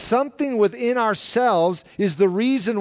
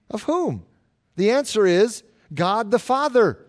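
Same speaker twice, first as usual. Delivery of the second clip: usual speaking voice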